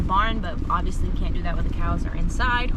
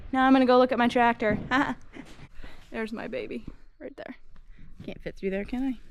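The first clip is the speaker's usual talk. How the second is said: ordinary talk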